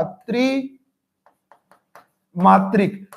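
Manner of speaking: त्रिमात्रिक stretched very slowly; drawn-out demo. Shown as a demonstration. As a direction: draw out त्रिमात्रिक, holding each sound long. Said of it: "त्रिमात्रिक होगा". (त्रिमात्रिक को बहुत खींचकर धीरे बोलना)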